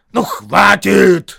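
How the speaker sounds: high, drawn-out loud protest; exasperated yell. A narrator's reading. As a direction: yell to end the argument. Two trolls stop the quarrel.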